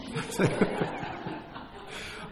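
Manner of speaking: breathy, voiceless chuckle; a quiet laugh at his own joke; amused chuckle. Real, not acted. Preacher joking with congregation about swallowing gnats, amused chuckle.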